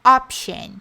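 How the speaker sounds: Option said slowly, OP-shon with a clear sh; teacher modelling the word Option; model pronunciation. Shown as a demonstration. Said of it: "Fácil de memorizar também".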